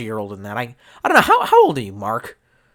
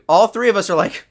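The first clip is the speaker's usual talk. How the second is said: ordinary talk, nothing marked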